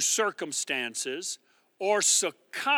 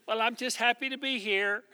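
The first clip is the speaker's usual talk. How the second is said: a lightly voiced, softer, smug shrug of a line; mock complacent; 5 dB quieter than usual. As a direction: play the contented quitter, mildly mocking. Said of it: "You're not gonna be content".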